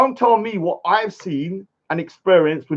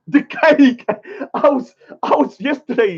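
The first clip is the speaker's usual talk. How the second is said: amused; 8 semitones higher than usual. Real, not acted. Laughing at the cake argument.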